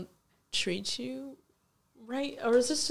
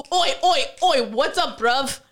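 mock London roadman; 2 semitones higher, 5 dB louder than usual. To friends, mocking London boys' greetings in a London street accent. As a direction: bark it like a London lad on the street. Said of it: "all you hear is".

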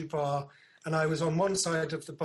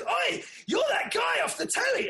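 imitated shout; 4 dB louder than usual. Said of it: "he goes".

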